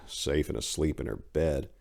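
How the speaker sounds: plain reading voice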